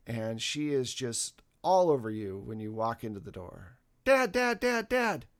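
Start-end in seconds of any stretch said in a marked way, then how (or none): 4.0-5.3 put-on high, eager child calling Dad; a small daughter bouncing with excitement; excited little girl. Daughter greets her dad, high and excited.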